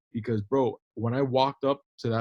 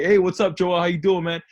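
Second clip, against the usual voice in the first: upbeat greeting; 6 semitones higher, 6 dB louder than usual. Retold or imitated. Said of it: "And I'd be like".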